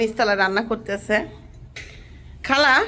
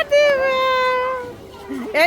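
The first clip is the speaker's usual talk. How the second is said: long drawn-out call; 11 semitones higher, much slower than usual. Real, not acted. Calling the child by name.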